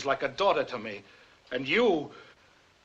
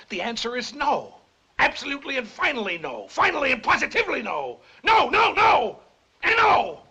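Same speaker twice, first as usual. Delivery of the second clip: from firm refusal up to a screamed no, higher and louder; building to a shout; 12 semitones higher, 7 dB louder than usual. Acted. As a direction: let each no climb until you explode.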